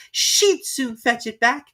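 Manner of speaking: put-on confident girl; Alice voice. Alice boasting of Dinah, slow and high.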